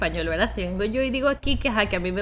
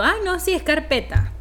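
eager; 7 semitones higher than usual. Retold or imitated. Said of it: "Y yo".

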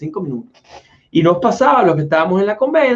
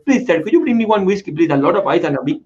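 mock Indian-accented English, some words slowed; playful Indian accent; 2 semitones higher than usual. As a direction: put on an Indian accent to order whiskey. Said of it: "hay que hablar con acento".

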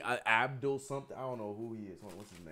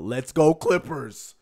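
loud, slow chant, pitched up; demonstrated yell; 4 semitones higher, 6 dB louder, slower than usual. Shown as a demonstration. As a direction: call it out as a drawn-out cheer. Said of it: "you are going to yell out".

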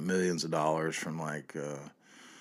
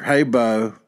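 mock Southern greeting; 4 semitones higher, 10 dB louder than usual. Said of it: "fake Southern shit".

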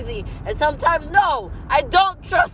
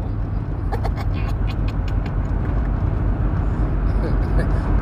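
unvoiced laugh; 9 semitones lower than usual. Real, not acted. Quiet breathy laughter.

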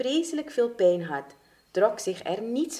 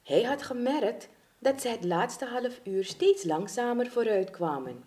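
ordinary delivery

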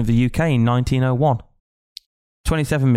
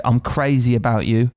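slow quote; slower than usual. Retold or imitated.

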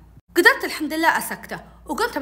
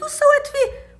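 high, louder cry of reproach; upset accusation; 5 dB louder than usual. Retold or imitated. Girl to nephew over her dead chick, upset accusation. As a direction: confront him over what he did.

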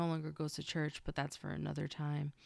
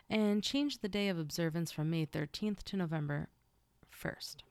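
normal talking voice

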